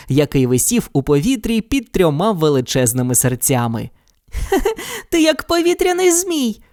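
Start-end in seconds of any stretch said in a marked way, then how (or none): 4.3-5.1 high bright giggle; high girlish giggle; girlish laugh. Acted. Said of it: "сміялася вона". 5.1-6.6 laughing. Acted. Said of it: "сміялася вона".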